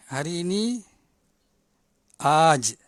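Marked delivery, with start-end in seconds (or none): 2.2-2.8 loud "aj" with a long held AA; long alif. Shown as a demonstration. Teacher modelling the word for today, loud long "aj". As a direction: call out "aj" as "aaj". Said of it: "alif kena panjang".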